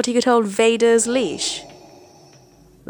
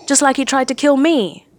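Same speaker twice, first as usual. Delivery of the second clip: higher, louder anguished howl; 6 semitones higher, 4 dB louder than usual. A narrator's reading. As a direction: cry it out like a betrayed grandson. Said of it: "Ben howled".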